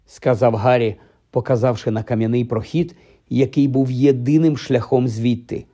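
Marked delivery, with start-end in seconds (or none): none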